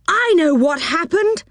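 high eager little man voice; Moonface. Acted. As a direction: chatter in a high, fussy voice. Moonface speaks.

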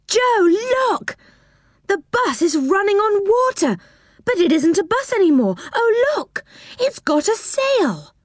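put-on high, excited child's cry of wonder; gasping with wonder at the sail; amazed shout. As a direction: call out in delighted amazement. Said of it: "gave a shout of amazement".

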